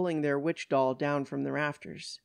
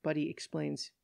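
ordinary delivery

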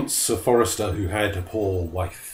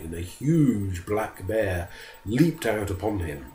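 ordinary delivery, nothing marked

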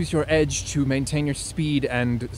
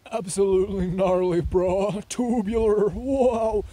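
high, goofy surfer-dude voice; mock surfer bro, pitched up; 6 semitones higher, slower than usual. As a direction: ham up a stoner surfer.

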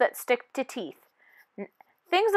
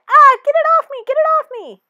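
shrill high panicked shriek, much higher and louder; 13 semitones higher, 10 dB louder, faster than usual. Acted. Tooth panicking over its cavity, screaming in panic. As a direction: scream it like something's crawling on you.

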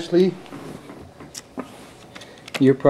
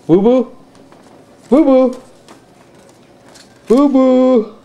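high, louder sing-song boo boo; 12 semitones higher, 4 dB louder, slower than usual. Real, not acted. Playing peekaboo with toddler.

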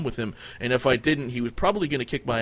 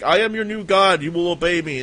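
booming, higher and louder, proclaiming to the beholders; mock god voice; 6 semitones higher, 6 dB louder than usual. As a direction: play a hammed-up new god laying down the law. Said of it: "we were like".

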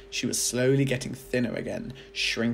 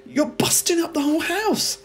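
put-on protesting boy; 7 dB louder than usual. A narrator's reading.

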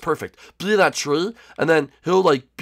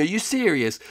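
normal talking voice

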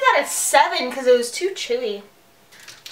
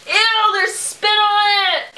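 high, slow, drawn-out ew; grossed out; 8 semitones higher, slower than usual. Real, not acted. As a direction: recoil at the spit. Spit found on candy.

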